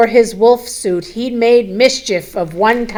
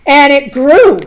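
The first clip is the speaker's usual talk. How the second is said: dramatic build; 5 semitones higher, 7 dB louder than usual. A narrator's reading. Dramatic read of growing forest.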